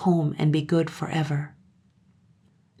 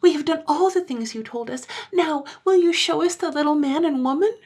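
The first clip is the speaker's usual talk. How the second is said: put-on high, loud pleading child voice; high childish pleading; eager children; 12 semitones higher, 3 dB louder than usual. A narrator's reading. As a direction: beg like excited little kids.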